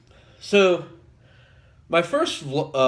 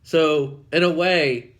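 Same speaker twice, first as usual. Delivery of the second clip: ordinary talk, nothing marked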